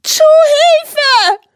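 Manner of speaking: a frantic woman screams for help; shrieking. A narrator's reading. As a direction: scream for help in full panic. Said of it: "kreischt sie".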